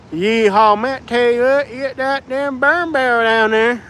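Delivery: high, twangy hillbilly drawl, stretched out; hammed-up Appalachian backwoods accent. Streamer mimicking an Alabama local, high hillbilly accent. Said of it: "The hillbilly accent is hilarious".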